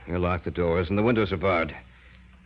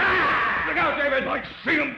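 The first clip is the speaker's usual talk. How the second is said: high, sharp warning cry; alarmed shout. Acted. Scientist warns David of the monster, panicked shout. Attacked by the creature.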